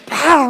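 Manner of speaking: loud, explosive "pah"; imitated bang. Preacher imitates the noise of the mighty wind, explosive. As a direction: burst out a sudden bang. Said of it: "Un ruido".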